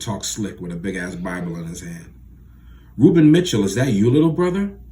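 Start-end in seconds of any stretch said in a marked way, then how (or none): 3.0-4.8 calling out. Acted. Father greets old friend loudly.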